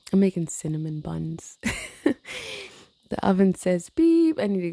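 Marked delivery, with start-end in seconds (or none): 1.6-2.7 a high little giggle, mostly air; short high laugh; high squeaky giggle. Real, not acted. Laughing at herself.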